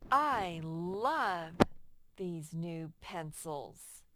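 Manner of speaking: slow, deliberate reading of a sentence; a teacher reading a sentence slowly for children; slow read-aloud. Shown as a demonstration. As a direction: read it out word by word for the class.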